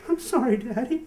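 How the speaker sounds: put-on high, small child's apology; sorry little kid. Little Le Chiffre apologising to his father, high and meek. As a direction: sound like a sheepish little boy. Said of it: "And he's like".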